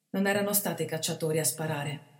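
plain reading voice

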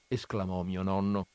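ordinary delivery

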